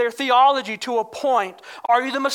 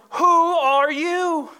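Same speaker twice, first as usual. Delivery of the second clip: drawn-out, higher-pitched demand; frustrated questioning; 6 semitones higher, 4 dB louder, much slower than usual. Retold or imitated. Delegation needing an answer from the prophet, frustrated and drawn out. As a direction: press the question in mild exasperation. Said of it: "It shows frustration".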